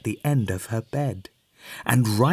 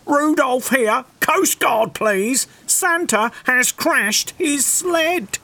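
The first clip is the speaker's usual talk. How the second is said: shouted; 12 semitones higher, slower than usual. Acted. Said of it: "He shouted".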